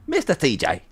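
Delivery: put-on catchphrase voice; impression of Mister C J. Streamer demonstrates his own version, lightly put on. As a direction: do your own take on the catchphrase. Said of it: "It's kinda like that with mine".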